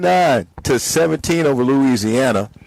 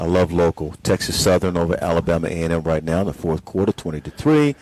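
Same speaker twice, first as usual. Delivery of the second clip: lower, hushed golf broadcast voice; golf commentator; 6 semitones lower than usual. Acted. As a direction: murmur the scores like a golf announcer. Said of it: "Is this your golf announcer's voice?".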